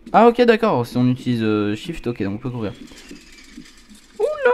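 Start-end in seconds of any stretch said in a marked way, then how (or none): 4.2-4.5 sudden high yelp; startled yelp. Real, not acted. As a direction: jump at a fright. Sudden scare in game.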